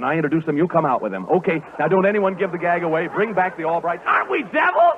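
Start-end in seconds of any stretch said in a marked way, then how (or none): 4.1-5.0 very high, mischievous squeal; gleeful cry. Pretend. Host to audience after setting up gag, gleeful cry. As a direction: crow it in a high playful voice.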